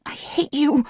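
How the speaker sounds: sharp high snap of hatred; snapping. A narrator's reading. Heroine snapping at her lover in anger. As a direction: spit it at him, high and cutting. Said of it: "I snap".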